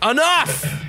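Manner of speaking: sudden high-pitched mock bellow; God's outburst. God ends the argument before striking Darwin, mock fury. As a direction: cut everyone off with one booming word. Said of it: "And then God is like".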